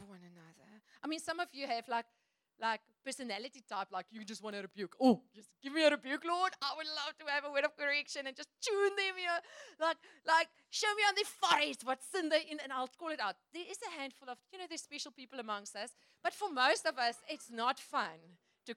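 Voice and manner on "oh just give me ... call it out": hammed-up eager prayer voice, louder and fast; mock pious eagerness, pushed loud; mock zealous rebuker. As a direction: parody a keen believer begging to rebuke.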